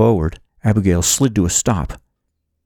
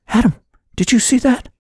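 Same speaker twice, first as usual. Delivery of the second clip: much higher-pitched, urgent question; startled alert. A narrator's reading. Heroine alerting her companion to danger, high and startled. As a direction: check anxiously, voice pitched high.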